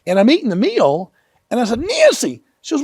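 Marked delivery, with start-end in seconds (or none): 1.8-2.4 put-on high scared little-boy call; scared child calling out. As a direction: call her name like a frightened kid.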